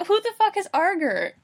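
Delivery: loud, high-pitched incredulous question; baffled outburst. Real, not acted. Reader baffled by a misread name, incredulous. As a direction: burst out in amused disbelief.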